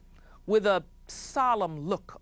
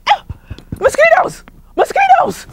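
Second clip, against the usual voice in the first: frantic shout; 15 dB louder than usual. Acted. Chief crying out about mosquitoes.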